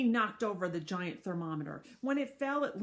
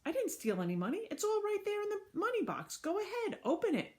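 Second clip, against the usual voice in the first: higher, pleading denial; Mister Klutz, pleading; 12 semitones higher than usual. Acted. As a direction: insist on innocence in a high voice. Klutz defends himself, voiced high.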